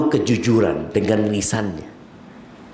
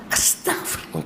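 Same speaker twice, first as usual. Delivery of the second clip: murmured astagfirullah; 6 semitones higher, 7 dB quieter than usual. Shown as a demonstration. Contrasting mindless istighfar.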